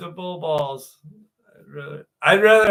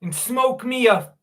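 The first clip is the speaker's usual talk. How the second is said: higher-pitched hammed-up British voice; mock British accent; 6 semitones higher than usual. Streamer joking about British food, mock British accent. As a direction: play a silly Brit ordering food.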